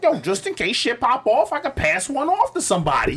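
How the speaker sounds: high-pitched imitation of a buddy; friend's voice. Armed friend explaining his two guns, high mimicking voice. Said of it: "He like".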